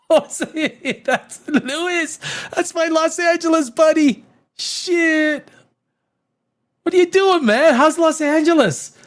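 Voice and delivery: high, bright hello to a friend; excited greeting. Real, not acted. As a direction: streamer calling out to his buddy, delighted.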